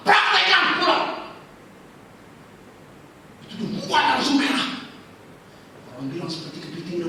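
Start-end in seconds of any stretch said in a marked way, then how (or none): 0.1-1.1 high, loud curt order; loud command. Retold or imitated. Pastor dismissing the churchgoer with a loud order. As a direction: send him off firmly.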